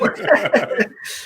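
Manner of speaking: breathy, voiceless burst of laughter. Real, not acted. Co-host cracks up after being told to stop, laughing. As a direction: crack up without voicing it.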